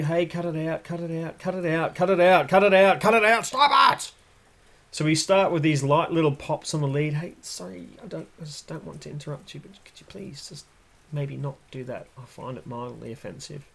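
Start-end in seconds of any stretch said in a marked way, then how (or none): 0.1-4.1 nagging to shouting. Retold or imitated. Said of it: "this nagging thing with the lead". 7.2-13.6 soft, fast, timid apology; very polite murmur. Acted. Dog voiced as overly polite.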